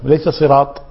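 ordinary delivery

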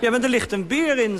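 high, loud, exasperated objection; exasperated protest. Retold or imitated. Husband protests to wife about the bear, loud and high. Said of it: "zei ik".

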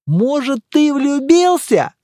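high, drawn-out, loud question; slow, high, provoking tone; incredulous teasing. Acted. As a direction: needle a friend with a stretched-out question. Teasing accusation of being in love.

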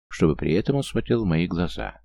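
plain reading voice

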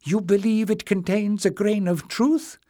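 put-on high, mumbling old man's voice; frail old man, sorry and flustered; old Joseph.

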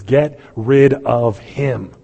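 drawn-out, hostile crowd cry; slow hostile demand. Retold or imitated. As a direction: spit out the rejection slowly. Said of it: "you're gonna scream with the Pharisees".

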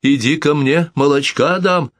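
high, coaxing call to a cat. Acted. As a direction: lure the cat with a treat. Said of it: "позвала миссис Маккензи".

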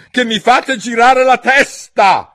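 very high, very loud, slow; angry shout. Acted. La regina grida ai giardinieri, esasperata. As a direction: keep yelling in exasperation. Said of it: "gridò la regina".

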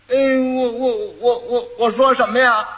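halting simpleton stuck on one word; stutter, then blurt the question; dim-witted groom at a loss for words; stammering fool. Acted.

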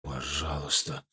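faint breathy whisper begging; whispered plea. A narrator's reading. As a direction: barely voice the plea. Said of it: "прошептал юноша".

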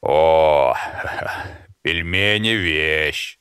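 lightly voiced stranger, mouth full; chewing loudly. A narrator's reading. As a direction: talk through noisy chewing, slowly. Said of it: "громко чавкая".

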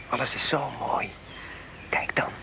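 whisper. Acted. Cold dawn, waking quietly.

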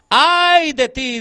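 slow, high mournful wail for God; lamenting cry. Acted. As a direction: cry out the woe slowly, grieving. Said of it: "Dios llora en ese momento".

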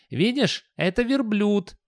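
very high, slow youth voice; skinny youngster. Acted. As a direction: point it out in a high, drawn-out young voice. Skinny youngster corrects boss.

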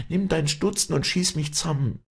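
very high, pleading woman's voice; anguished high entreaty; desperate Wally. Acted. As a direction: beg urgently, pitched far up. Wally begs to be shot.

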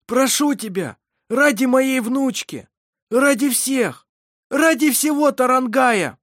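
loud, high, desperate begging; urgent plea. A narrator's reading. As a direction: plead harder with each phrase, loud and high. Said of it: "попросил Ировар". (громкая отчаянная мольба)

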